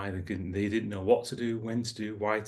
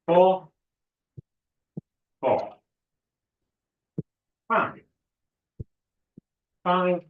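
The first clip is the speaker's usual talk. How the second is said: aerobics instructor; 9 semitones higher, 6 dB louder, slower than usual. Acted. Instructor calling out double counts.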